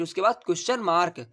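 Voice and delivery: ordinary talk, nothing marked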